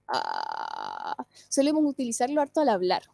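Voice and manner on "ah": compressed creaky low ah; vocal fry. Shown as a demonstration. Teacher demonstrates how vocal fry feels. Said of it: "todo un poquito más comprimido".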